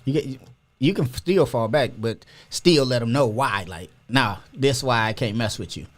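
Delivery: usual speaking voice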